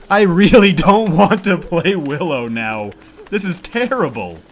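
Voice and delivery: loud, slow laughing complaint. Real, not acted. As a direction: laugh out the frustration. Laughing frustration at playing Willow.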